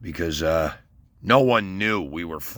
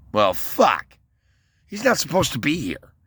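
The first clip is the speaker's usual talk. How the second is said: exasperated, pitched-up mock outburst; retold exasperation; 11 semitones higher, 5 dB louder than usual. Man annoyed at the boyfriend's presence, exasperated and higher. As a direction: throw up your hands at the surprise boyfriend.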